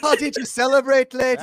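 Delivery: high, loud, hammed-up Irish accent; lilting mock Irish at a high pitch. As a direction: put on a silly stage-Irish voice. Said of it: "Give us the Irish accent".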